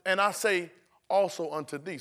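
measured, deliberate recitation; slowed-down reading. Shown as a demonstration. Preacher now reading as Jesus meant, measured deliberate delivery. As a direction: give the verse room, word by word. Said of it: "But Jesus, slow down".

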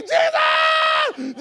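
high, loud cry of the name; shouted Jesus. Retold or imitated. Preacher showing how people call Jesus, a high loud cry. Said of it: "Y'all know you be like".

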